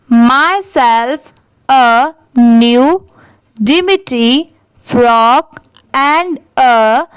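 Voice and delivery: very slow, phrase by phrase, for students to repeat; teacher reading aloud at a crawl, pausing after every few words; slow dictation. Shown as a demonstration. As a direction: read it slowly so the class can echo each chunk. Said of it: "आप भी मेरे बाद repeat करिए".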